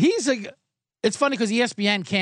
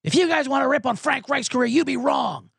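higher, pushy mocking owner voice; mock Irsay rant; 7 semitones higher than usual. Owner lecturing the media in a higher mock rant. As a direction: ham up the angry owner lecturing the press. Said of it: "He goes".

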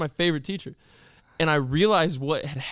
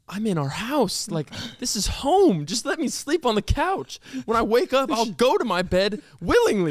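put-on higher-pitched indignant kid's protest; mad; 6 semitones higher than usual. Kid woken on the couch by parents, mad protest. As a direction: re-enact an exasperated plea, building higher. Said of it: "I was always so mad at it".

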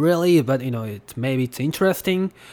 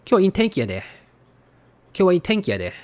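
Kansai dialect, a bit higher, with the pitch lifting on "wa"; Kansai intonation; 5 semitones higher, much faster than usual. Shown as a demonstration. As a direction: say "Kyou wa ii tenki ya de" Osaka style. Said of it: "in Kansai dialect or Osaka Ben".

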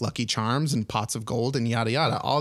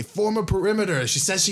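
raised, high-pitched watchman's call; city watch; 8 semitones higher than usual. Acted. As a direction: call out orders down an alley. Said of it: "and they say".

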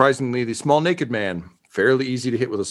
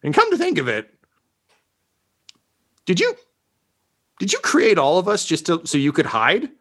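suspicious henchman; 6 semitones higher than usual. Acted. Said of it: "And he's like".

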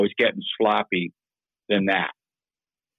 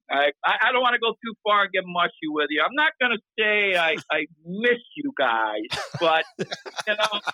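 laughing; 8 semitones higher than usual. Real, not acted. Laughing off getting mushy.

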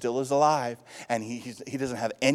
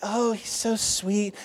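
mock sweet gushing; 7 semitones higher, slower than usual. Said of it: "Jesus was always this like".

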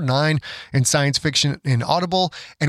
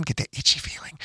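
whisper; 7 dB quieter than usual. Pretend. Teasing listeners about craving book.

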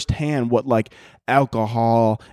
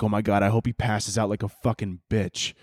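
usual speaking voice

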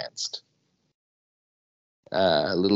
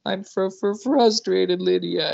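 put-on crying child; 11 semitones higher than usual.

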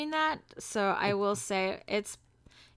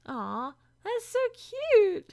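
cute rises high and loud; gushing, touched aww; 13 semitones higher, 3 dB louder, slower than usual. Real, not acted. Touched by husband's remark.

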